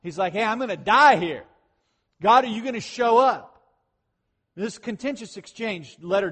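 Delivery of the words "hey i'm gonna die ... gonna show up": louder, panicked plea to God; mock-frantic cry for help; freaking out. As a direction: Moses fearing for his life, calling out. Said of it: "He's freaking out".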